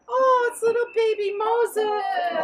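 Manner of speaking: high, loud, drawn-out coo; hammed-up baby-adoring squeal; cooing over a baby. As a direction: gush adoringly, stretching it out slowly. Princess coos over found baby.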